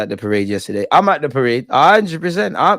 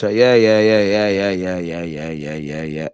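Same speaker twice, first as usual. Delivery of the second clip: dismissive run-on yeahs; 6 semitones lower, slower than usual. Real, not acted. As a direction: start firm, then let it fade right away. Brushing off talk, repeated yeahs.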